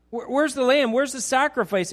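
put-on a boy anxiously pressing his father; urgent worried asking. Shown as a demonstration. Isaac anxiously asks his father where the lamb is, pressing. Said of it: "They've done this before".

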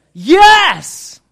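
a loud, high, thrilled yes; the fiancée's overjoyed scream of yes; joyful yes. Retold or imitated. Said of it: "you're hoping that the next words out of her mouth".

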